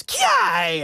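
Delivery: very high imitated karate shout; karate yell. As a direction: squeal a karate cry. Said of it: "he goes".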